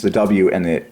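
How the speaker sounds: ordinary talk, nothing marked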